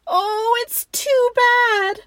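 high, loud drawn-out wail; sobbing girl. A narrator's reading. Girl grieves over the missing body, loud tearful wail. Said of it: "sobbed Lucy".

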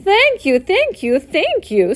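high, loud fussy goose; bow and gush your thanks. A narrator's reading. Goose thanking Charlotte for congratulations, high gushing voice. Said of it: "nodding and bowing shamelessly".

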